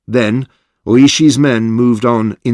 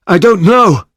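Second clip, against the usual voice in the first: raised, answering call; 9 semitones higher, 5 dB louder than usual. A narrator's reading. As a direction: shout back the answer. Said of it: "called Yoshida".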